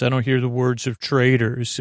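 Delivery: ordinary talk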